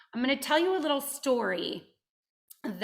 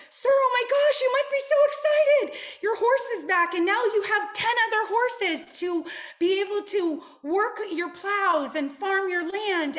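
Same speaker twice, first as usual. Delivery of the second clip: very high, loud, gushing excitement; overjoyed workers; 9 semitones higher, 6 dB louder than usual. Acted. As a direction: squeal it like thrilled farmhands. Workers thrilled horses returned.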